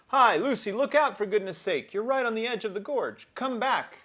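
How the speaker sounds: high, loud calling out; shouting a warning. Acted. Others shouting at Lucy on the edge, loud alarmed call. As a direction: call out in alarm across the cliff. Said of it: "the others shouting".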